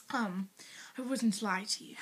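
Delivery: a soft conspiratorial whisper; whispered aside. Real, not acted. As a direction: whisper it, teasing, still in the British accent. Teasing whispered aside to teacher.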